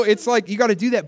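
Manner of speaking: normal talking voice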